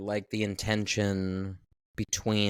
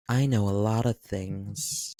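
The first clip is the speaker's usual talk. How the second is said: seductive. Retold or imitated. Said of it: "in her seductive ways".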